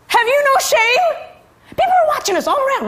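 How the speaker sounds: very high, loud scandalized scold; hiss-scold her in public, mortified; mortified daughter scolding mother in the lot; shrill scold. Retold or imitated.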